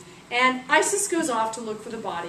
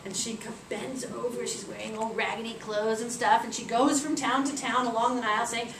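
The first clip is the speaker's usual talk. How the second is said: ordinary delivery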